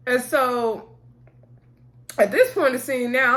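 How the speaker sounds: normal talking voice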